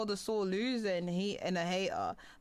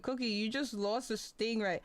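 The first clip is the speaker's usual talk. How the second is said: ordinary talk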